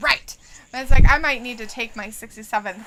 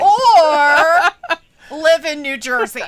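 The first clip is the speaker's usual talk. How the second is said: loud laughing shout of Or; laughing loudly at the joke; 3 semitones higher, 8 dB louder, much slower than usual. Real, not acted. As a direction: blurt it out through laughter. Laughing reply to would you rather.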